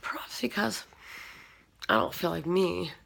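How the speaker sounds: ordinary delivery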